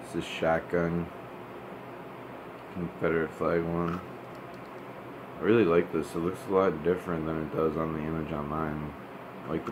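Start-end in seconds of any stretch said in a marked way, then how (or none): none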